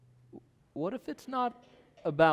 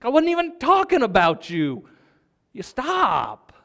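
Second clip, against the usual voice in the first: high, loud mock scolding; acted-out exasperated outburst; exasperated parent; 10 semitones higher, 8 dB louder than usual. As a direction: snap back at a nosy kid. Parent snapping back at eavesdropping kid.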